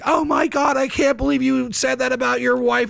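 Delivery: hammed-up gasp of outrage; shocked mom. Stay-at-home moms reacting online, mildly mocked scandalized tone. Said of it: "stay-at-home moms were like".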